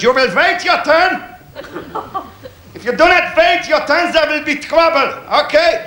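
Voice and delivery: mock German officer, high and slow; German accent. Comedian playing the German yard boss in a stern German accent. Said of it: "He says to this German".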